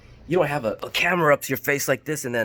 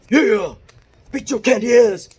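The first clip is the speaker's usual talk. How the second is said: much higher and much louder wrestling trash-talk bellow; The Rock; 11 semitones higher, 10 dB louder than usual. Shown as a demonstration. Vlogger shows a cranked-up wrestler persona, loud bellow. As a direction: roar it at the arena crowd like a wrestler. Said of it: "like The Rock".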